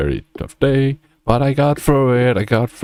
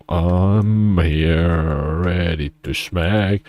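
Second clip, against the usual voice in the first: usual speaking voice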